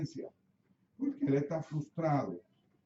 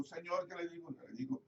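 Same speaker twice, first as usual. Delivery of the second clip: softer, hushed repetition of the plea; 10 dB quieter, faster than usual. Retold or imitated. Pastor praying softly to the Lord before the hospital room. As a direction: drop to a quiet, inward prayer.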